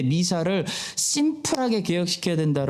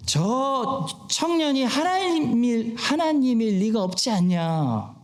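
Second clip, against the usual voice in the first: higher, slow mock disbelief; incredulous townspeople; 7 semitones higher, slower than usual. Nazareth neighbours doubting the young man is God, acted disbelief. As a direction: draw it out as a scoffing objection.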